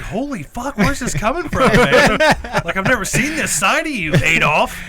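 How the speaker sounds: high, loud mock outrage; hammed-up stunned disbelief; shocked exclaim. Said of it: "I was like".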